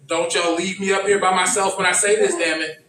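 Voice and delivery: normal talking voice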